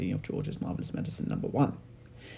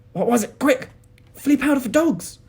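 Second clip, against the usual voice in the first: high, loud, quick; urgent excitement; 12 dB louder than usual. A narrator's reading. Mister Cranky and George trade urgent lines, high and loud. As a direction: fire it out fast.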